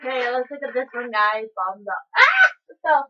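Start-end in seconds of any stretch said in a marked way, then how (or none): 2.2-2.5 sudden high shriek; surprised yelp. Real, not acted. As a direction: yelp as it falls. Tower piece fell.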